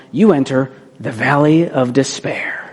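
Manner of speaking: slow, eerie whisper; spooky whisper. Acted. Speaker warning the audience about the valley, whispering slowly. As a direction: let it out like a dark secret. Describing the darkest stage.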